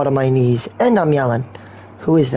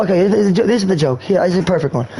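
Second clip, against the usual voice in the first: normal talking voice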